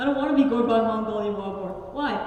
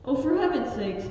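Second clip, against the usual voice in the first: normal talking voice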